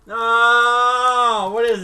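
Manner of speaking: shocked yell of disgust; loud shout. Real, not acted. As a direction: scream it out. Disgust at bad flavour.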